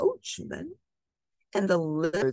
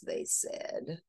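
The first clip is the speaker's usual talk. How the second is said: plain reading voice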